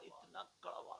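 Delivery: इतना कड़वा whispered, breath only; whispered repeat of इतना कड़वा; whispered echo. Acted. Echo of bitterness, hushed.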